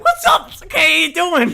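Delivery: high, loud, bouncy greeting; dog greeting its tired adult owner; happy dog voice. Pretend. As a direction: gush like a dog thrilled to see you. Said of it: "the dog's just like".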